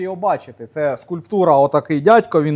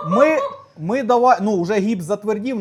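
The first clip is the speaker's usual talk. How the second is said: ordinary talk, nothing marked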